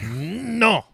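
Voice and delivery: high, drawn-out "Nnn-no" at an idiot; exaggerated high-pitched refusal; mock dismissive no. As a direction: imitate people scoffing at something idiotic. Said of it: "you're always saying something idiotic and they're like".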